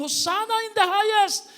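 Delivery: high, loud joyful crowd cheer; crowd shout. Retold or imitated. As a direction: sing it out like the crowd. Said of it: "all the people were singing and shouting".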